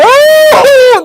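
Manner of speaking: very high, loud drawn-out cry; hammed-up distressed howl; mock wail. Mimics wailing reunion guest.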